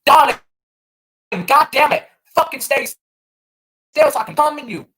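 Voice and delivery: loud, high hammed-up demand; mock New York girl. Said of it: "In New York City, it's like".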